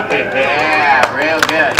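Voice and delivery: normal talking voice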